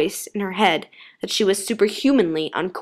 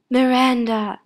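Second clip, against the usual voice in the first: strained, choked call; gasped out; 4 semitones higher, slower than usual. A narrator's reading. Doctor constricted by branches, strained gasp. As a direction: call out while being strangled. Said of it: "he managed to gasp out".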